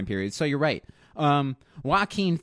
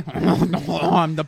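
mock French accent; 5 dB louder than usual. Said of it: "Not even, like, a touch".

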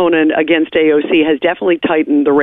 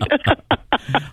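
laugh; 7 semitones higher, 6 dB quieter than usual. Real, not acted. Laughing at mocking impression.

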